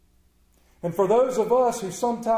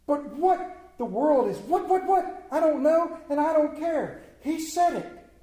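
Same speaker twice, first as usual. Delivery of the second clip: higher, eager believer's voice, questioning; simple believer; 7 semitones higher than usual. Acted. Preacher voicing a believer who trusts blindly, higher and eager. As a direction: play a plain-hearted believer who doesn't care why.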